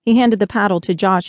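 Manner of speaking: ordinary delivery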